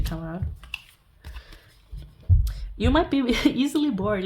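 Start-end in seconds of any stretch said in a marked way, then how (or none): 2.8-4.2 laughing through the words, higher and louder. Real, not acted. Reader teasing viewer about boredom, laughing. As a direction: tease them with a real laugh.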